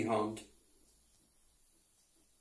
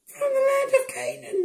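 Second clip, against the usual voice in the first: very high, squeaky brothers voice; brothers' voice; 4 dB louder than usual. A narrator's reading. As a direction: pitch way up for the brothers.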